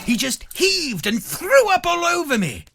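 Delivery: high, loud, building exclamation; shocked climax. A narrator's reading. Groupie tells of being thrown up on, loud shock. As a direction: build to a near-shout of gross-out horror.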